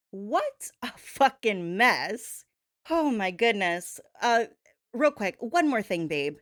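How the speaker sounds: normal talking voice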